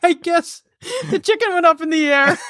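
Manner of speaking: high voice squeezed by laughing; real helpless laughter. Real, not acted. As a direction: tell it while cracking up. Cracking up at own story.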